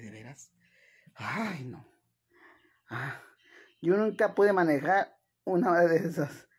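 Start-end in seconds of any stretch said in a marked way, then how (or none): none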